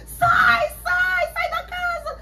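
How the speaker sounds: high, crying scream to get out; panicked high-pitched crying shout; frantic yell. Retold or imitated. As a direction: shriek at them to leave the house. Woman yelling on phone, house fire.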